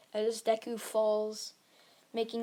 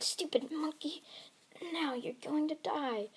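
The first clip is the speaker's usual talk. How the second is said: higher, half-whispered threat; acted villain's scorn, pitched up; villain's insult; 6 semitones higher, 4 dB quieter than usual. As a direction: sneer a death threat without shouting. Villain insults hero facing blast.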